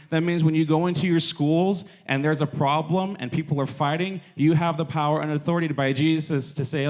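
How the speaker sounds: ordinary talk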